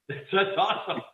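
chuckling through the words; laughing. Real, not acted. Host laughs at own remark.

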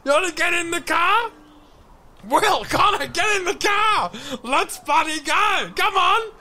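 put-on high-pitched excited holler to the kids; calling out.

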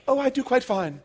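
high, mock-British reply; mimicked British accent. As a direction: parrot the English lady's accent back. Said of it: "in her beautiful British accent".

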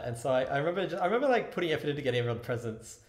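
ordinary talk

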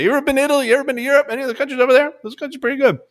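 hammed-up high-pitched boasting about Europe; mock know-it-all traveler. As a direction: lecture like a snobby globetrotter. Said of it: "I say".

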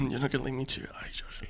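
softly muttered line run-through, trailing into a whisper; quick, hushed rehearsal to yourself; under the breath. Pretend. Actor running his line before the take, under the breath.

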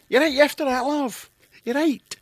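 high-pitched, put-on Scots chat; Scottish accent. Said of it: "And that's how they talk".

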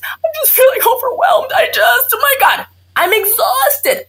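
high, frazzled near-shout of an overwhelmed mother; overwhelmed new mom. Retold or imitated. Doctor acting out a patient with baby blues, frazzled and high. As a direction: pitch it up like you're at the end of your rope. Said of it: "What baby blues is".

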